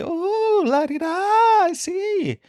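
slow falsetto la-di-da; hoity-toity mockery. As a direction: draw it out in a very high mock-impressed squeal. Teasing Henry about National Library.

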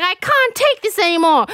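high, overwrought wail of protest; imitating someone at breaking point; mock tearful outburst. Kieran crying before he walks off, mock tearful. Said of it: "Kieran starts crying more".

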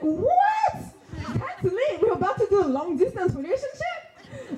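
high-pitched, laughing excitement; excited disbelief. Retold or imitated. Girlfriend hearing he's on the same page, excited. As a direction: squeal it, thrilled and half laughing. Acted excited reply to boyfriend.

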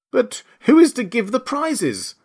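slow, high, clamouring question; chorus of voices. A narrator's reading. Whole party asking about prizes, clamouring chorus. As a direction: ask it high and drawn out, as a crowd. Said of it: "quite a chorus of voices asked".

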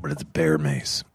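whispered aside; hushed whispered punchline. Real, not acted. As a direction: breathe it as a secret. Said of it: "whispered aside".

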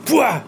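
sharp loud imitated brake sound; braking noise. Telling friends how he braked on a tailgater, loud mock noise. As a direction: blurt a sudden noise for the slammed brakes. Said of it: "hit my brakes real quick".

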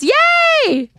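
high, drawn-out happy squeal; excited cheer. Real, not acted. Cheers at news of pictures.

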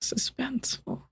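breathy whispered echo. Real, not acted. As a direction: whisper it back dramatically. Echoing the word back, whispered.

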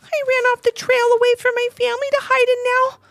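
very high, small crying voice; tearful little bunny. A narrator's reading. Lost bunny telling Levi why he ran, tearful. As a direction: sniffle through the story. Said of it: "cried the little bunny".

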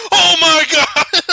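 very high, loud, stretched exclamation; mock freak-out. Host re-enacting partner's realization, high loud freak-out. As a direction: explode in hammed-up amazement.

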